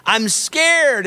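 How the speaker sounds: high-pitched imitation of a frightened little girl; mock-scared child's plea, pitched well up; scared daughter. Preacher acting his daughter on the roller coaster, mock-scared. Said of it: "it was like".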